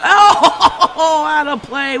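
high laugh breaking through the words. Real, not acted. Gamer laughing at the play on screen.

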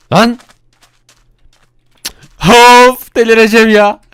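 loud, high frustrated groan then complaint; fed-up boss exploding; exasperated outburst. Acted. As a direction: blow up at the useless crew. Boss loses patience with henchmen.

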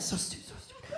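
muttering at yourself in bed, whispered; self-loathing whisper. Retold or imitated. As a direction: hiss it at yourself, disgusted. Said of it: "just like that".

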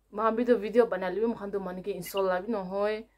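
ordinary talk

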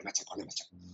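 a soft whispered echo of her own word; whispered repetition. Real, not acted. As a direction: let the words fade to a whisper. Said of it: "fui machacona".

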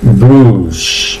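"Bruise" as long "brus", no I; low, falling, stretched "Bruise"; silent I. Shown as a demonstration. Teacher repeats for learners, "Bruise" with silent I. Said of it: "la I no suena".

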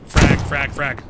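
loud urgent callout; grenade warning yell. Real, not acted. Teammate shouting grenade callout.

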